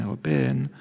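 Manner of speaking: ordinary talk, nothing marked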